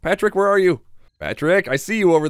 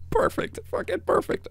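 laughing whisper; 8 dB quieter than usual. Real, not acted. Giggling whisper at success.

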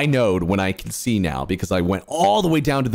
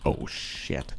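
whispered oh shit; 9 semitones lower, 11 dB quieter than usual. Real, not acted. Sudden scare.

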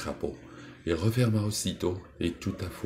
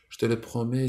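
higher, quick promise; mild exclamation; 7 semitones higher, 3 dB louder than usual. Acted. Princess hastily promises the toad, mildly exclaiming. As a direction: blurt out the promise. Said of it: "s'écria la fille du roi".

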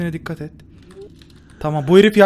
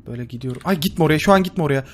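ordinary talk

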